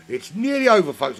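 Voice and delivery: high, loud rising excitement; excited. Real, not acted. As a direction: call it out to the audience. Commentator nearing race end.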